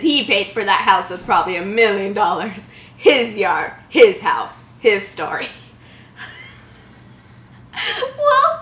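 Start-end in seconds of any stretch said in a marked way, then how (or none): 3.0-5.5 mocking. Real, not acted. Mocking the phrase. 7.7-8.6 mom voice. Acted. Mom's voice.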